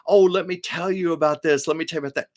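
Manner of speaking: high, eager gushing fan voice; mock chatty fan. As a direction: rattle on like an overexcited fan. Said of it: "this man going on and on".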